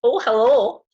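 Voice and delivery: loud, high comic German greeting; high, loud mock German voice; attempted German accent. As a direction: copy a German-accented hello, hammed up. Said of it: "attempt a German accent here".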